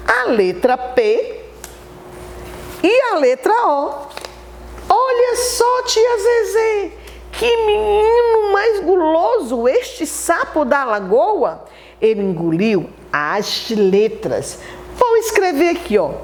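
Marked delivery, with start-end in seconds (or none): 4.9-11.6 put-on high-pitched exclaiming child voice; drawn-out, high mock amazement; astonished child. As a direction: marvel like a scandalised little pupil. Child reacting to the greedy toad.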